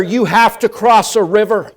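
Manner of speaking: normal talking voice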